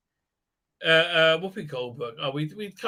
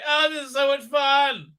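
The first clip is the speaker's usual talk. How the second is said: high, loud gushing fan impression; mock wine mum; 10 semitones higher, 4 dB louder than usual. Host mocking wine-mum fans of the show, fake delight. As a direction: gush like an easily thrilled mum. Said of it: "wine mum Karens, essentially".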